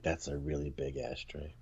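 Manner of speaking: very low, very soft deadpan; muttered joke aside. Retold or imitated. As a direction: drop it to a low murmur as a sly joke. Joke aside voiced for The Rock.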